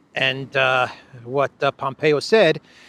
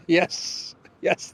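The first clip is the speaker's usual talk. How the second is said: usual speaking voice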